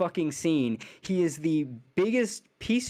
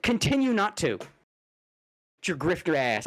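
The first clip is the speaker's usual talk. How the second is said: usual speaking voice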